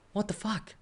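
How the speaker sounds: high, hushed what-the-fuck; stunned disbelief. Retold or imitated. Reacting to an angry emoji, high incredulous hush. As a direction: gasp it out in mock shock, pitch way up. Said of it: "So I was like".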